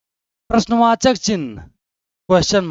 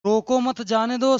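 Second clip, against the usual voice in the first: "रोको मत जाने दो" run together in one phrase; no pause until end; 4 semitones higher than usual. Shown as a demonstration. Teacher shows students a full stop only at the end, no pause. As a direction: read रोको मत जाने दो straight through, without a break. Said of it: "सबसे last में लगा हुआ पूर्ण विराम".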